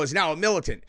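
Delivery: ordinary delivery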